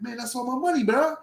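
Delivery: high-pitched, acted-out complaint; aggrieved plea. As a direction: plead high and aggrieved for your cash. Pleading over taken money.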